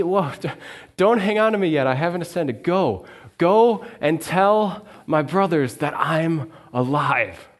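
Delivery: a kind, urgent "wait" before sending her off; Jesus holds Mary back, then sends her with the news; Jesus gently stopping her. Acted.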